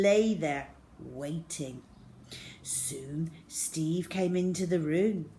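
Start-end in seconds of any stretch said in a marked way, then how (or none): none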